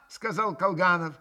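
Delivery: plain reading voice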